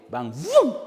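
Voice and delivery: a loud high vroom like a bike taking off; zoom sound. Retold or imitated. The preacher imitating a motoconcho speeding off, loud vroom. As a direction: burst out with the engine noise.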